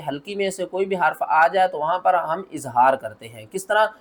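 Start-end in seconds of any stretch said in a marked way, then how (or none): none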